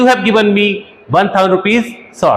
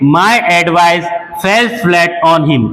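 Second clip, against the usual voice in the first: very slow, careful teacher read; very slow example for learners; very slow model sentence; much slower than usual. Shown as a demonstration. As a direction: dictate word by word. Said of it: "next देखिए".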